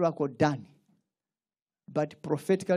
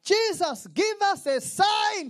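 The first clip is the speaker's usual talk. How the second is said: demanding crowd; 13 semitones higher, slower than usual. Retold or imitated.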